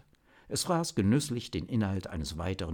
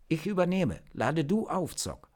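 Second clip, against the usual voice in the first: higher, firm call across the fight; 9 semitones higher than usual. Acted. Natascha takes over and tells Zock to recharge, calling out. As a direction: step in and call it out. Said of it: "rief Natascha".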